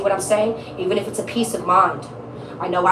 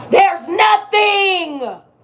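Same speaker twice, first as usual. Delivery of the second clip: shout; 9 semitones higher, 4 dB louder, much slower than usual. Acted. Cutting off a client's longing.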